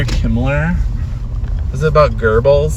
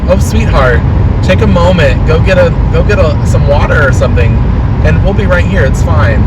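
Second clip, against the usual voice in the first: higher, gentle and sweet reassurance; kind customer; 5 semitones higher, 4 dB louder than usual. Pretend. An imagined customer comforting the coughing worker, higher and sweet. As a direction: soothe a coughing worker like a kindly aunt. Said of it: "Can you say to me".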